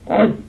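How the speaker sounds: Hud said loud and high; old English Hud. Shown as a demonstration. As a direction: sound out Hud with a strong h. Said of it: "Like this".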